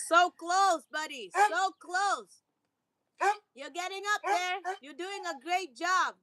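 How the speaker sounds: proud owner praising her dog; high praise for the dog. Real, not acted. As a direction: cheer the dog on sweetly. Praising dog trying for ball.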